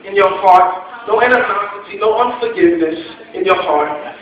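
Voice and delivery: loud, driving preacher's call; preaching at full force. Acted. Preacher urging the congregation to forgive everyone, loud and rising. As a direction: build it up and hammer each name home.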